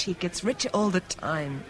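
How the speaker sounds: ordinary talk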